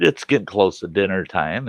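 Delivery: plain reading voice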